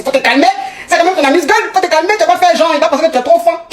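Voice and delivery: high, insistent inner voice; talking yourself down, pitched high; urging restraint. Retold or imitated. Inner voice telling her to calm down.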